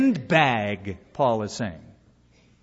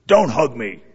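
imitated growl, louder than usual talk; growled rebuff; 3 semitones lower, 6 dB louder than usual. Pastor to pastor refusing a hug, growled. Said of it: "he growled".

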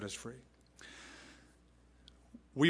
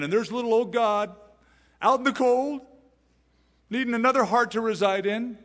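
mocking pity; 8 semitones higher, 5 dB louder than usual. Mocking a needy little God.

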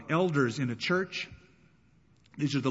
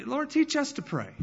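higher, eager asking; eager plea; 11 semitones higher than usual. Retold or imitated. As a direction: plead with sudden eagerness.

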